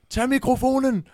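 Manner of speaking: high, loud imitated order; urgent command. Performer handing over the microphone on stage, loud and urgent. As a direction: call it out over the music. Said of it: "så s-, siger han så".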